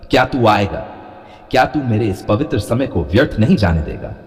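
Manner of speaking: ordinary talk, nothing marked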